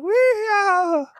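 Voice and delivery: very high hillbilly holler; mock farm-boy yee-haw; yokel farm-boy whoop. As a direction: whoop it like an excited country bumpkin. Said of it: "yokel kind of farm boy accent".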